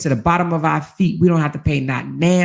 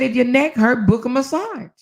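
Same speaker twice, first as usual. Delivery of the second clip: husband's advice; 8 semitones higher than usual. Retold or imitated. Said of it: "He said".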